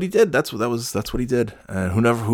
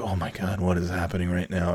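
awkward; 2 semitones lower, 8 dB quieter than usual. Retold or imitated. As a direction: drop to an uneasy undertone. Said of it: "like, awkwardly like".

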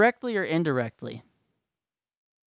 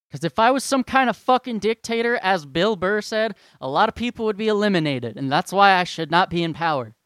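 heated rant; 4 semitones higher, 6 dB louder than usual. Real, not acted. Heated self-mocking rant.

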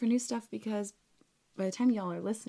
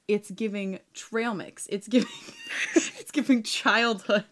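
sassy retort; 3 semitones higher, 5 dB louder, slower than usual. Retold or imitated. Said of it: "I personally was like".

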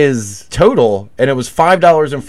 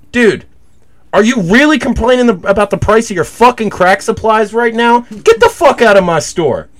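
higher, louder mock yell; retold angry yell; 10 semitones higher, 3 dB louder than usual. Retelling yelling at the crackhead in the store, higher louder angry yell. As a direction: tell him off, half performed. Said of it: "I was just like".

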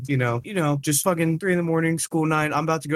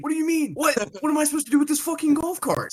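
much higher, fast, freaking out; panicked disbelief; 14 semitones higher, faster than usual. Retold or imitated. Left alone with the stolen golf cart, much higher panic. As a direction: squeal in disbelief at being ditched. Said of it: "I'm like".

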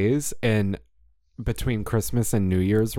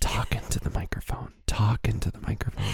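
soft stage whisper; imitated whisper; 5 dB quieter than usual. Echoing the whispered instruction to a colleague on the call, stage whisper. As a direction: whisper a repeated prompt, mimicking. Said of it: "And then I whispered to him".

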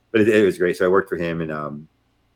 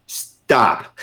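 sharp, higher mock yell; retold yell; 5 semitones higher, 4 dB louder than usual. General cutting off the captain's question, retold yell. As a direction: cut the captain off with a sternly raised voice. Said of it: "he yelled at me".

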